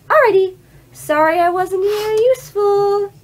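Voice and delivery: put-on old lady voice. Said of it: "[name]'s old lady voice kills me".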